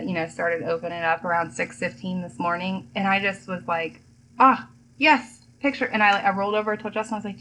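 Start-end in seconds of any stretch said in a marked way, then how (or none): 4.4-5.9 higher and louder burst of delight; spring awake with an idea; sudden eagerness. Retold or imitated. Waking up eager to shoot.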